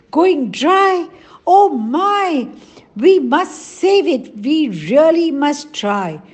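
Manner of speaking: high, loud worried exclamations; urgent and upset, rallying the village; alarmed cry. A narrator's reading. Elders to the villagers, high and loud with urgency. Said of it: "cried the elders".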